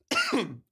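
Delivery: rough cough on water; choking cough. Real, not acted. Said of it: "Sorry, I'm choking on my water".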